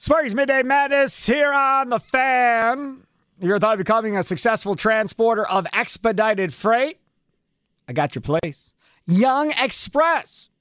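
upbeat host-read commercial; radio ad read. Acted. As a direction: sell it like a radio spot.

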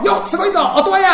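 high, loud call from the gallery; loud, high kabuki fan's cheer. Pretend. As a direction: shout it like a kabuki fan cheering an actor. Kabuki audience cheering actor.

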